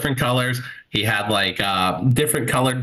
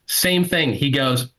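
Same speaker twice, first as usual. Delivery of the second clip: usual speaking voice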